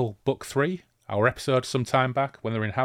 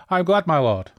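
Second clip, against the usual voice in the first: eager traitor; 8 semitones higher, 8 dB louder than usual. Acted. Traitor answers the horseman.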